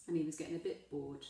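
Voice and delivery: ordinary delivery, nothing marked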